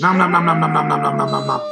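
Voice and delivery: chomp it down greedily; wolf devouring the mermaid, greedy chomping; gobbling noises. Acted.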